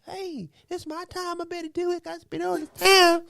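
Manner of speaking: high, soft, preachy mimicry; mock self-important voice. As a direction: parody someone excusing their own lateness. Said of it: "But it's okay when they say".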